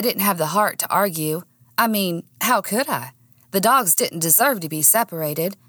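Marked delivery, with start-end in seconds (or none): none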